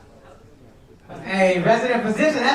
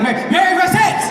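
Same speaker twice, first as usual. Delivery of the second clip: high, stammered retort under pressure; mock panicked answer; 9 semitones higher, 3 dB louder than usual. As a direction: blurt it out high, like a husband caught out. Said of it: "He felt her look at him".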